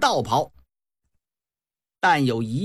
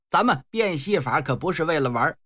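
ordinary delivery, nothing marked

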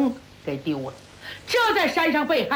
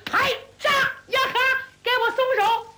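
higher, ringing fighting yell; battle cry; 12 semitones higher than usual. Acted. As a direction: shout mid-duel. 战斗呐喊. (交战时的高声呐喊)

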